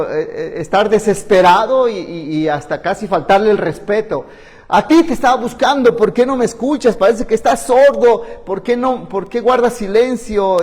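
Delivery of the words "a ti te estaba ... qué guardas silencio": raised, pleading demand to God; desperate plea. Retold or imitated. Psalmist crying out to God, desperate and raised. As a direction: complain urgently, nearly disrespectful. Desperate cry, almost disrespectful.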